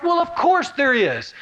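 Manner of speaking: high, slow "of course"; emphatic answer. Shown as a demonstration. Preacher answering Paul's question, emphatic. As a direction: drive home the obvious. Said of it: "the emotion Paul wants to bring out".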